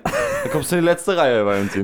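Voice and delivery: a hint of a stern teacher; lightly voiced teacher scolding; playing the teacher threatening a pupil; mock teacher threat.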